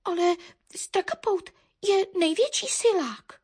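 high, halting little bird; hesitant sparrow. A narrator's reading. Sparrow argues back to Baron, high and hesitant. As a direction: offer it uncertainly, with a gap. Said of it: "poposkočil nerozhodně vrabčák".

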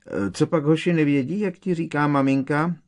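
high, loud teasing; mocking taunt. A narrator's reading. Taunting cousin with mother's pet names.

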